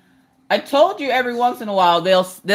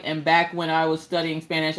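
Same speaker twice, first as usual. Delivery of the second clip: ordinary talk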